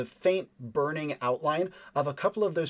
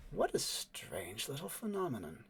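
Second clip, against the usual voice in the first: soft, smooth, unhurried lady musing aloud; a poised woman, quietly amused; smooth woman's voice; 7 dB quieter than usual. Acted. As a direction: let it glide, cool and quiet. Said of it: "the smooth voice of the woman".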